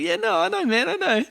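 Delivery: very high, loud mock squeal; imitated squeaky concession; high-pitched protesting agreement. Retold flustered agreement about thongs.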